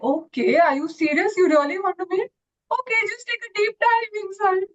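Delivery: a lightly voiced old wave, teasing then brisk; wise old wave.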